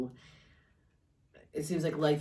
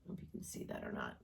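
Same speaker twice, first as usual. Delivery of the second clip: quick, low, whispery aside; hushed aside; 14 dB quieter, much faster than usual. Real, not acted. Reader checking whether viewers can see the card, hushed aside. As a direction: drop to a fast murmur, barely voiced.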